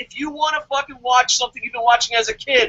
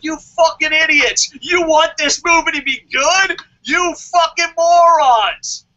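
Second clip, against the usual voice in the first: loud shouted insults, last line slow; mock furious yell; 4 semitones higher, 5 dB louder than usual. Host pretending to scream at moviegoers, mock furious. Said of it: "I'm gonna be like".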